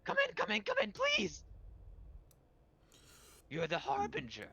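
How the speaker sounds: very high-pitched, urgent creature voice; frantic high pleading, then awed; eager hyena man. Acted. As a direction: squeal it like an excited hyena man. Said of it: "the hyena man goes".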